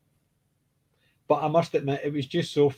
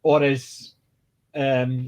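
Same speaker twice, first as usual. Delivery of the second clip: normal talking voice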